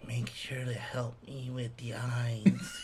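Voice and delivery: slow, soft whisper; drawn-out eerie whisper, imitating the child; whispered kid quote. Kid turning to Dad at reveal, slow whisper.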